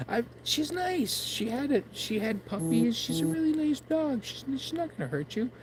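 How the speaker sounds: high, plaintive call after them; pleading in a high voice, a bit mocking. Calling after departing strangers.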